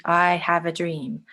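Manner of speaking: slow, I have a dream, each word given weight; slow speech-style delivery; slow repeated quote. Shown as a demonstration. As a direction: draw it out slowly, dream falling. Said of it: "which he says quite slowly".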